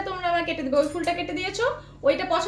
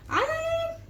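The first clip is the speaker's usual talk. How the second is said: very high, soft coaxing coo; high coaxing call; 6 semitones higher, 5 dB quieter than usual. Real, not acted. Owner coaxing the parrot onto her hand, high and soft. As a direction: lure the bird with a high call.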